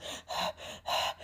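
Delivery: heavy, voiceless panting; puffed-out panting. Acted. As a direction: pant like a wolf after a run. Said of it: "He's all puffed out".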